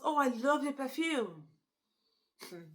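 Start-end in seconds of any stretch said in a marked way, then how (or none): 0.0-1.5 high, gushing mock flattery; sweet-talker. Sweet-talker praising his boss's perfume, hammed-up high gushing. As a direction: coo it higher, laying on the praise. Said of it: "So he told the boss".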